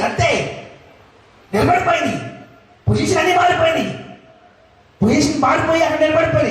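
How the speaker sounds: high, urgent sermon delivery; excited preaching. Acted. Preacher telling congregation the pillars moved into action, excited preaching. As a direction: preach it pitched right up, driving toward the action. Preacher builds up God's repositioning.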